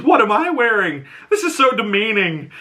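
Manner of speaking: high-pitched, scandalized complaint; acted-out horror at being humiliated; appalled outrage. Retold outrage at own costume.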